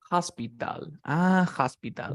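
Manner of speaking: "hospital" with the t as a quick tap r, "aspiral"; flapped t. Shown as a demonstration. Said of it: "Es algo como así, mira". (la t de "hospital" como una r suave)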